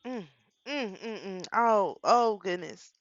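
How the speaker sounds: slow, choked-up 'oh goodness'; fighting back real tears; real crying breaking through; on the verge of tears. Real, not acted. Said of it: "I'ma try not to cry too much".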